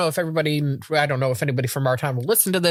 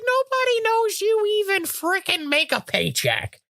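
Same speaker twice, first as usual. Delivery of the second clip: exasperated lecture; 11 semitones higher than usual. Retold or imitated. Exasperated quote to husband.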